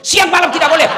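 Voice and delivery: loud, firm answer; emphatic ruling. Retold or imitated. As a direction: slap down the question. Ustadz answering loudly.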